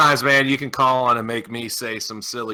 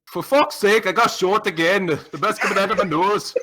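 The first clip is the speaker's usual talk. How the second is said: higher-pitched attempt at a Scottish accent; 6 semitones higher than usual. Shown as a demonstration. Co-host repeating the Scottish caller's sentence, put-on Scottish accent. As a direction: copy the Scotsman's accent, hammed up. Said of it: "do the Scottish accent".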